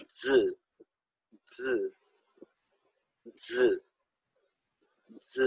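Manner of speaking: ز said hard and buzzing, with force; ز with a tense, strong buzz; firm z sound. Shown as a demonstration. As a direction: press the ز out firmly. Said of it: "تھوڑا سختی سے پڑھیں گے".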